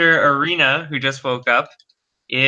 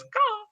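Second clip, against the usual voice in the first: high soft bird caw; raven caw; 7 dB quieter than usual. Acted. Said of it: "Raven wakes up and goes".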